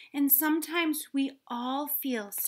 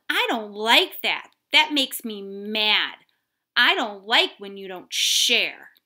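louder, firm statement of being mad; firm upset words; 8 dB louder than usual. Shown as a demonstration. Child telling a friend who won't share, firm and louder. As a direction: say it clearly so they hear you're mad. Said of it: "use your words and tell them".

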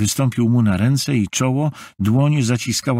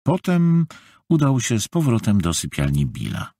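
plain reading voice

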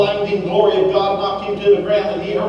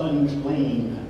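"unclean" said soft and low, almost a whisper; hushed cry; 7 semitones lower, 5 dB quieter than usual. Retold or imitated. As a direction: breathe "unclean" softly and low. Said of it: "the cries of".

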